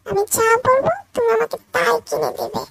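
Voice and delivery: over-the-top loud sweet talk; loud fawning. Acted. Girlfriend loudly flattering her rich boyfriend for shopping.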